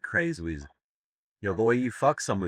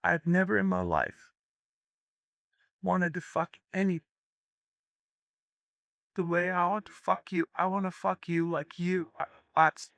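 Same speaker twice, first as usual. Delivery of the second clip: high, hammed-up seductive woman; mock sultry woman, pitched way up; breathy high woman's voice; 9 semitones higher than usual. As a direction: purr it out like a lustful castaway.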